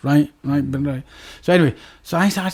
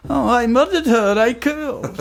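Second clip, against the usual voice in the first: higher, offhand mock Swedish accent; casual Swedish accent; 8 semitones higher, slower than usual. Cellmate casually confesses in mock Swedish accent. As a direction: drop it lightly in Swedish accent. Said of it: "Swedish accent".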